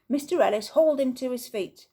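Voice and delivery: ordinary delivery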